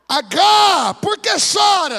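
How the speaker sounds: loud, high shouted call, slowing at the end; booming angel. Acted. Angel calling to weeping Hagar, booming shout. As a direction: call down from heaven to a weeping woman.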